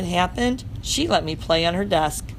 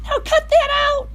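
tiny squeaky hamster voice, much higher and louder; hamster squeak; 5 dB louder than usual. Acted. Hamster scolding the barking dog in a high squeak. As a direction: squeak it out like a cross little hamster. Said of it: "I squeaked at him".

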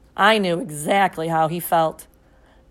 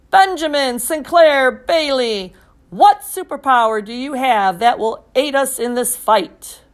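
commanding superhero voice; 5 semitones higher, 6 dB louder than usual. A narrator's reading. Father demanding his son's power, commanding superhero voice. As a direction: announce it like a comic-book hero. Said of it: "in the most commanding superhero voice he could muster".

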